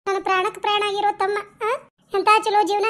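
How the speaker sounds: ordinary talk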